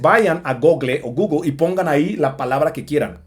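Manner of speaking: normal talking voice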